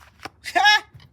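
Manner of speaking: high burst of laughter; delighted laugh. Real, not acted. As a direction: laugh out in triumph. Card confirms her prediction.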